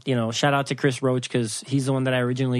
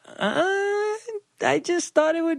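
tiny, very high meek voice; mock-timid falsetto reply; sheepish excuse; 14 semitones higher than usual. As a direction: squeak out a feeble excuse. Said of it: "And I was like".

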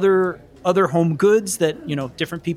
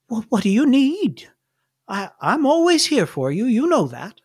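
put-on high, lilting old-lady voice; kindly old nun; 5 semitones higher than usual. Sister Mary offers Sam help in the office, reassuring. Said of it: "And she says".

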